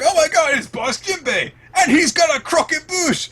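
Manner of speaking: very high, loud squealing excitement; high thrilled shriek at a hero's arrival; excited fan. Retold or imitated. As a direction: gush like a starstruck fan. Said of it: "it was like".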